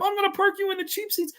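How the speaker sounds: high froggy cartoon voice; Kermit-like try. Second try at Bobcat's threat, sounding like Kermit. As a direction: squeak it like Kermit the Frog. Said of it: "That sounded like Kermit the Frog".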